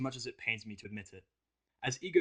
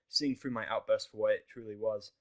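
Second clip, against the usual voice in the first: plain reading voice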